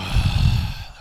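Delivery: long audible sigh; heavy sigh. Acted. Character looking at Olivia before answering, heavy sigh. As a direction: let out a big breath. Player acts character's reaction.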